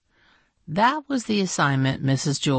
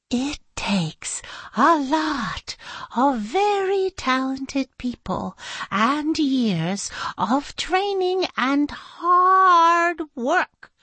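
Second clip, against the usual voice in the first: high, very slow, grand teacher explaining; high, drawn-out teacher lecture; slow earnest explaining; 10 semitones higher, slower than usual. Acted. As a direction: lay it out with reverence. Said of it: "she explained".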